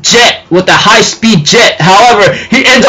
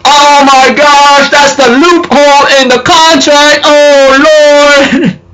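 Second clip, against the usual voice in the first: raised, slowed cry of disbelief; shocked exclaim; 7 semitones higher, slower than usual. Retold or imitated. Viewer spotting the contract loophole, high shocked cry. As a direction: catch the loophole in horror. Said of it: "I'm like".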